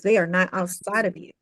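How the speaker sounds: ordinary delivery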